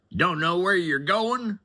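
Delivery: high, loud scornful disbelief; incredulous sneer. Retold or imitated. Rude passenger mocking his limo driver, high loud sneer. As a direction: sneer it at the driver like he's an idiot.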